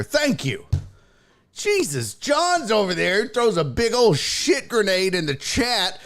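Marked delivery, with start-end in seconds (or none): none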